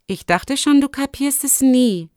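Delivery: put-on high, young character voice; young fox girl. Rissa pleased Lumi finally understands. (hohe Stimme des Fuchsmädchens)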